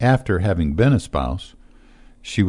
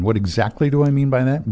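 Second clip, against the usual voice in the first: normal talking voice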